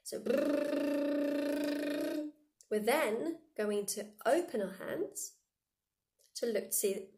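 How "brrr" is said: higher, long voiced lip trill; lip roll. Shown as a demonstration. Teacher demos the fly buzzing inside the hand. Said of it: "it's inside your hand".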